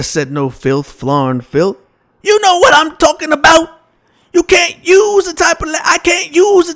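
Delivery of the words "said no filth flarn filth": much lower, mumbly Cosby voice; low Cosby impression. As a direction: drop way down into a lazy Cosby mumble. Cosby denying saying filth.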